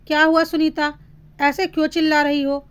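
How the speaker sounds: usual speaking voice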